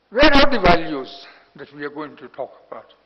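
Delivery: loud, exasperated rhetorical question; indignant outburst. Real, not acted. Elder panelist berating the audience over lost values, loud and indignant. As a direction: fling the question at the room.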